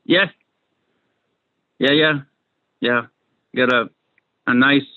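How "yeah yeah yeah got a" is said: ordinary talk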